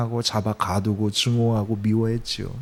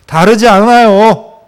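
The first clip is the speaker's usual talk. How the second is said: slow, much louder and higher proclamation; emphatic declaration; 7 semitones higher, 11 dB louder, slower than usual. Real, not acted. Preacher answering his own question to the church, slow loud proclamation. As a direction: proclaim it slowly with full force. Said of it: "preacher's emphatic answer".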